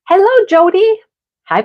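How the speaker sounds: ordinary delivery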